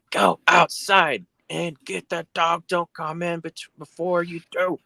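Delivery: high, slow, each word punched; mock furious wife, slow and high; angry wife. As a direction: bark it out word by word like she's hitting him. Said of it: "each word is punctuated with a punch".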